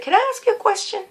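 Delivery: soft, quick, high-lilted question; gently request permission, keeping the volume down; real quiet. Retold or imitated. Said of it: "I got real quiet".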